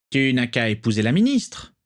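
sneering suggestion in another voice; mocking. A narrator's reading. Parkinson mocking Rosier, a sneering jab. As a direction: needle him with a jeer. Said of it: "railla Parkinson".

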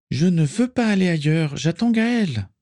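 high-pitched protest, not shouted; insistent refusal. A narrator's reading. Patient refuses to leave her vigil. (refus insistant, voix aiguë)

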